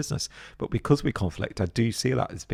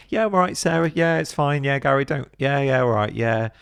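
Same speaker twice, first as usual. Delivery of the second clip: much higher, rapid cheery yeahs; breezy brush-off; 6 semitones higher, 3 dB louder than usual. Retold or imitated. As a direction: wave it away with a bright smile.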